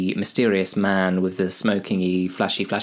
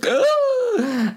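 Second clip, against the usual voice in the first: a very high, louder yelp of pain; pained cry; 4 dB louder than usual. Acted. Frodo stabbed on the mountain, high pained yelp. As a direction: yelp as the blade goes in. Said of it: "and he goes".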